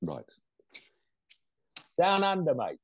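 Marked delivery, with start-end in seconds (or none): 2.0-2.8 put-on jokey Aussie accent, pitched high; mock Australian accent. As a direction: ham up a quick Australian twang on "Down under, mate". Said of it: "are you allowed to mock their accent".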